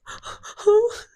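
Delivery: high, loud mock-tearful protest; squealing, whiny fake distress; panicked denial. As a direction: plead innocence in a hammed-up panic. Acts panicked denial when accused.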